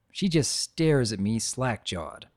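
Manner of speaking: ordinary delivery, nothing marked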